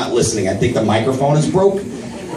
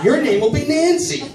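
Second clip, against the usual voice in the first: high-pitched silly naming voice; mock proclamation; 13 semitones higher than usual. Dad filling out adoption papers, high mock proclamation. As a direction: announce it in a high, comic voice.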